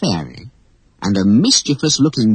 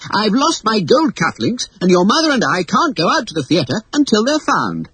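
flustered father; 12 semitones higher than usual. Acted. Father frantic over lost cufflinks.

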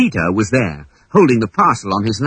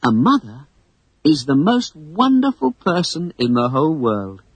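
lightly voiced girl, tender and homesick; a touch of a sad young girl; gentle storybook girl longing for home; Wendy, wistful; 7 semitones higher than usual.

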